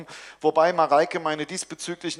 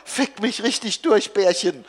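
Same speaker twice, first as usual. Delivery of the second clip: excited plea; 6 semitones higher, 4 dB louder than usual. Acted. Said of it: "presst Mareike hervor".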